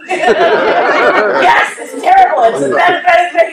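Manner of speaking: high, loud mimicry through laughter; imitated indignation. Girlfriend mimics boyfriend condemning the sign, high and laughing.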